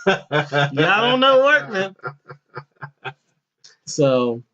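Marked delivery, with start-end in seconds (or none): 0.1-2.0 y'all don't know work up high; slow, high mock scold. Teasing the workers in a high, slow voice. Mocking them as he tells it.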